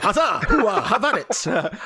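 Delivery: high, loud battle cries; hammed-up charging warrior yell; loud gung-ho soldier. GM voices flailing warrior battle cries.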